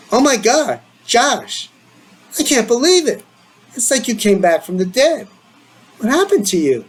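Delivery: lightly voiced excited friend; excited friend Michael.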